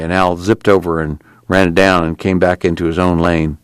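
ordinary delivery, nothing marked

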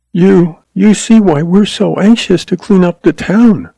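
high, anxious woman with a touch of quiver. Acted. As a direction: plead in a high, mildly trembling voice. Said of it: "her voice quivering".

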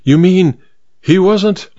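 surprised. Acted. Duchess realises in shock.

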